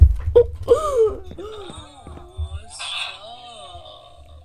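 long high shrieking laughter. Real, not acted. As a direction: cackle helplessly. Laughing at voice changer.

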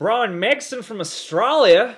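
exaggerated Australian accent. Shown as a demonstration. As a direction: say it like an Aussie. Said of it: "[name], do your Australian accent".

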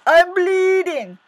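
loud, very high wail; yelping. Acted. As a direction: yelp it out, stretched and alarmed. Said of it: "the fox yelped".

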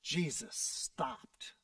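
very low, very soft, slow; hushed. A narrator's reading. Preacher pausing the story as Jesus stops, hushed. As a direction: drop to a hushed stillness. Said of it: "dramatic pause after shout".

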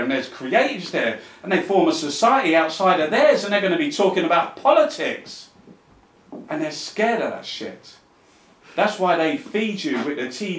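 impassioned rant. Real, not acted. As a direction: drive each point home to the crowd, loud and high. Impassioned rant to audience against the powerful.

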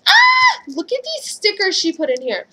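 very high squeal, then eager look call; excited shriek. Real, not acted. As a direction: squeal at the stickers. Finds stickers.

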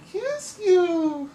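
shrill high squeal of kiss you; sudden shriek. Acted. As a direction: burst up into a squeal. Drawing threatens, then kisses.

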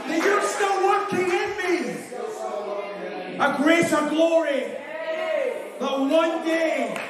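high, driving revival preaching. Real, not acted. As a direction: preach it like you feel it. Preaches at full force.

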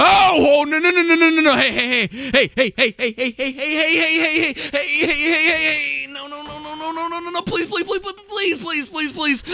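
shrill, high, frantic screaming; panicked screaming. Real, not acted. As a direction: scream and beg for your life.

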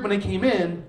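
usual speaking voice

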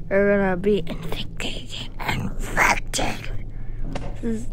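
whispered warning. Acted. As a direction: breathe it out, unvoiced. Spooky aside about zombies.

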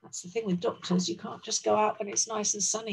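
ordinary delivery

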